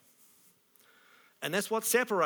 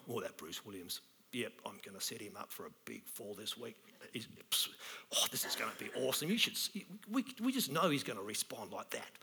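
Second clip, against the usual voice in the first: put-on low, quiet, scheming old coot voice; cranky old God; 7 semitones lower, 7 dB quieter than usual. Cranky God plotting against Bruce Williams, low scheming mutter. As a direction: mutter the plot low and gleeful like a grumpy old man upstairs. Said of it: "a cranky old coot up in heaven".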